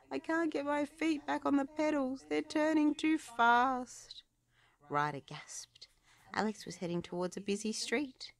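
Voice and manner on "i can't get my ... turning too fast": panicked. A narrator's reading. Alex out of control.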